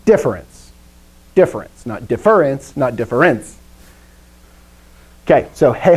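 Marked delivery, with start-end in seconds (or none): 2.1-2.8 difference as dif-FER-ence; misplaced stress. Shown as a demonstration. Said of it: "can you hear the difference?". 3.0-3.6 difference as differ-ENCE; misplaced stress. Shown as a demonstration. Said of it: "can you hear the difference?".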